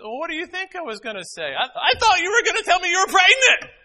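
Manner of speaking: high, loud, indignant daughter voice; daughter exasperated. Retold or imitated. Daughter telling her father what she feared, indignant. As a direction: let her blurt it out, outraged. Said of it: "She said".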